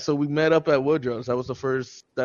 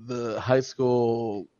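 ordinary talk, nothing marked

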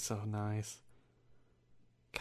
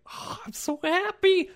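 high, slow gushing squeal; squealing happy; 6 dB louder than usual. Retold or imitated. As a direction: gush like an overjoyed kid. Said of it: "I was like".

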